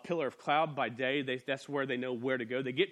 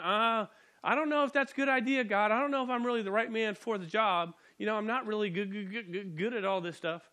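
higher-pitched, hesitant acted protest; nervous and stammering, hammed up; whimpering reluctance; 5 semitones higher than usual. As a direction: play a scared little man making excuses. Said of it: "whimpering, scared, stuttering little man".

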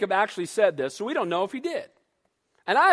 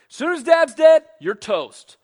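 angry threat; 5 semitones higher, 8 dB louder than usual. Retold or imitated. Esau shouting at Jacob that he is toast, loud. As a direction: spit out the threat at full volume.

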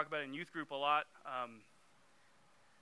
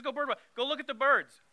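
quoted command said higher and louder; louder, higher retelling; 10 semitones higher, 7 dB louder than usual. Retold or imitated. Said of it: "He says".

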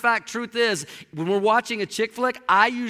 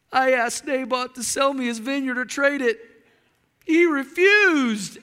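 higher, whiny sulking voice; whining king; 4 semitones higher, 4 dB louder than usual. Retold or imitated. King pouting to his wife, high and whiny. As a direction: whine it like a spoiled child.

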